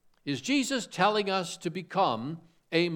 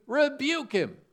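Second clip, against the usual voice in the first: mock stern rebuke; 10 semitones higher, 4 dB louder, slower than usual. Preacher mocks a harsh rebuke reading.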